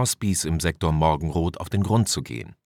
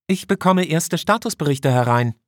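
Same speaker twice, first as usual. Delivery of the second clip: much higher female officer voice; 10 semitones higher, 4 dB louder than usual. Acted. Officer Tanaka reports an outage to her commander, high-voiced. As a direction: answer as the officer, pitched high.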